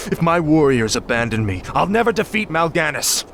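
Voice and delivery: ordinary talk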